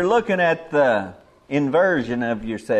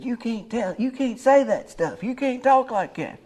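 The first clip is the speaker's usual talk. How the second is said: imitating the young man; 7 semitones higher than usual.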